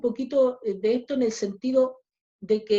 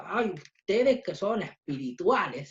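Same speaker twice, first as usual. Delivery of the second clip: low, sarcastic jab with mock admiration; mock sarcasm; 5 semitones lower than usual. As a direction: say it sarcastically, pitched down. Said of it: "no está hablando con sarcasmo".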